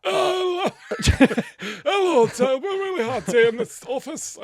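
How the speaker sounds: high, loud put-on northern voice; loud high mock husband with laughter; husband coming home. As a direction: play the weary husband, laughing through it. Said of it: "I sound like Treebeard".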